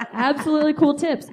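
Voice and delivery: giggling through the words; laughing. Real, not acted. Laughing banter over tips.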